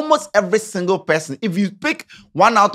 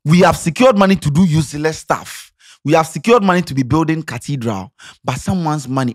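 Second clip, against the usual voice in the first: ordinary talk, nothing marked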